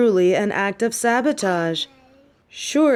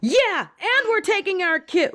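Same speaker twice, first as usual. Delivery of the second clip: bold announcement; 12 semitones higher than usual. Acted. Sudden bold declaration.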